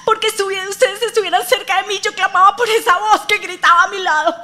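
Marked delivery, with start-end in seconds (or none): none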